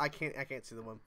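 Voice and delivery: ordinary talk, nothing marked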